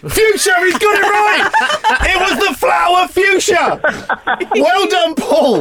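high, laughing excitement; delighted laughing cheer. Real, not acted. Host thrilled and laughing as the caller guesses the word. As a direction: crow with delight at the right answer.

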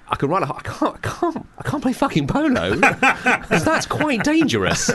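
suddenly realising the danger, squeaky and slowed; mock panic. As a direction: voice jumping up high in comic alarm.

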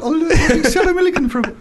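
high, loud female Geordie impression; Sally Milligan. Shown as a demonstration. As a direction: put on a squeaky-high Geordie woman's voice. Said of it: "A female Geordie?".

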